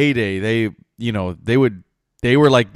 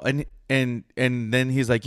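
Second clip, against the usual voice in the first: normal talking voice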